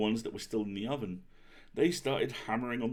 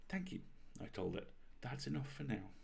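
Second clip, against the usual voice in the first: soft thanks; 9 dB quieter than usual. A narrator's reading. Baker softly dismissing the cookie.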